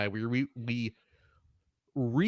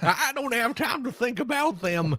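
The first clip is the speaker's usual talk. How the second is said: put-on old prospector; 10 semitones higher, 3 dB louder than usual. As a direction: play a grizzled old prospector. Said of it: "old anime prospector".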